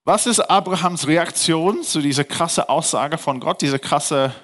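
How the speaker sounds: ordinary delivery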